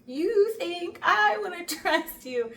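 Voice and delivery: high, loud, slow disbelief; mock incredulous. Acts incredulous reply to his request.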